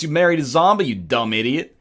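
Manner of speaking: ordinary talk, nothing marked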